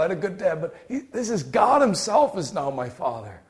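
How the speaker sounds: ordinary talk, nothing marked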